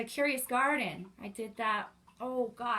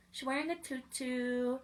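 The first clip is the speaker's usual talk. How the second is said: ordinary delivery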